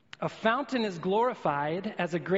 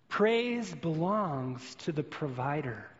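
slow, weighty statement; slower than usual. Shown as a demonstration. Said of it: "key sentence, stated slowly".

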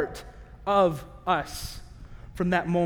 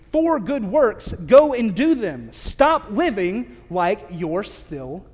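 forceful preaching; 4 dB louder, slower than usual. Acted. Sermon exhortation climax.

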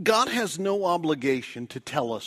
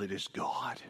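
lower, softer, whispered; whispered emphasis; 9 semitones lower, 9 dB quieter than usual. Real, not acted. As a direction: let it fall to a hushed whisper. Sermon point dropped to hush.

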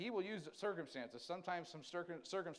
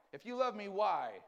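higher, louder, pleading "why?"; demanding why; 6 semitones higher, 9 dB louder, slower than usual. Retold or imitated. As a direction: push the "why?" up and out. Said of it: "The big why".

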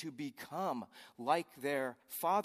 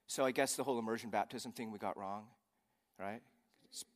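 usual speaking voice

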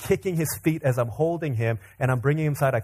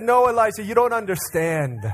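put-on high, raised plea to a child; pitched-up mock exasperation; exasperated parental protest; 7 semitones higher, 3 dB louder than usual. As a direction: protest as a misunderstood dad. Retold protest to his son.

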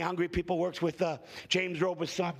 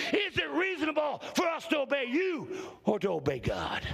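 full-force preaching; 6 semitones higher than usual. Acted. Preacher rousing the congregation with full-force shouted preaching. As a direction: preach it out at the top of your voice.

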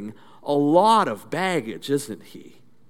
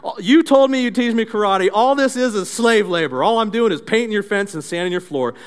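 higher, raised-voice mock tantrum; acted indignant outburst, easing slightly; Daniel; 5 semitones higher, 3 dB louder than usual. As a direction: complain loudly like a frustrated student. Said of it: "stomping and yelling at Mister Miyagi, telling him".

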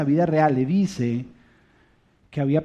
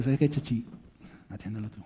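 quiet restraint; 6 semitones lower, 9 dB quieter than usual. Retold or imitated. Holding back anger with son.

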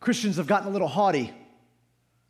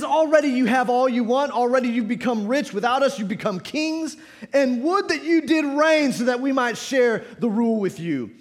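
raised, emphatic pulpit reading; forceful scripture reading; 7 semitones higher, 6 dB louder than usual. Real, not acted. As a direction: read the verse out with force. Reads scripture in preaching force.

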